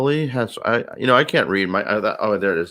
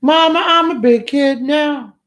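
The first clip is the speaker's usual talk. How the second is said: put-on little kid voice; 4 dB louder, slower than usual. Character voice during improv.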